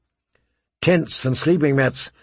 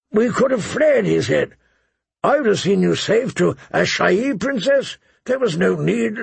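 pleading knight; 6 semitones higher than usual. A narrator's reading. Jorah pleads with Dany.

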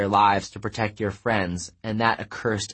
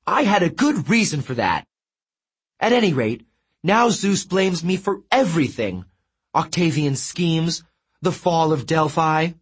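much higher, preening god; vain Apollo boasting; 6 semitones higher than usual. A narrator's reading. Apollo boasts to his sister, much higher and preening. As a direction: show off a perfect tan with glee.

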